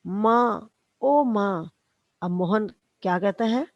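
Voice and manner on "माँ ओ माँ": calling out for mother in pain, louder; a groaning cry for mom. Retold or imitated. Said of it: "जोर से कराहकर". (दर्द से कराहते हुए ज़ोर से पुकार)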